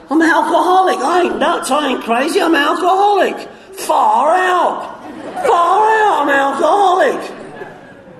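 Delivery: triumphant shout of discovery; elated realization. Retold or imitated.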